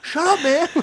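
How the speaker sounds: high, loud pretend protest; hammed-up irritated yelp; mock annoyed outburst. Prank victim snapping at the caller, high mock outburst.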